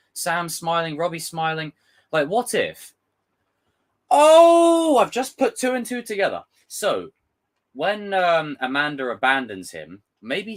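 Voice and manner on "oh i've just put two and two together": high loud gasp of discovery; a real lightbulb moment; sudden realisation. Real, not acted. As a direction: blurt it as it clicks. Sudden realisation about trailer.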